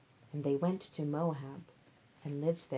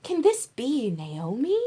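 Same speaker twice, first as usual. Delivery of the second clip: higher, louder and slower amazement; astonished exclamation; 6 semitones higher, 7 dB louder than usual. Acted. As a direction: gasp it out in disbelief. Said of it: "the woman exclaimed".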